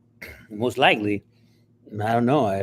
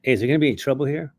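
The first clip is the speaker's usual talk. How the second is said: nervous quick question; 3 semitones higher, much faster than usual. Retold or imitated. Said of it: "and I go".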